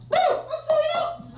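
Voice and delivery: very high, drawn-out comic squeal; panicked squeal. Acted. Comedian answering the Hells Angel, in an exaggerated terrified squeal. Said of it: "Which I said".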